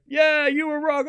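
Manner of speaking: high, slow jeering crowd voice; mocking crowd. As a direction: mimic fans jeering the line judge.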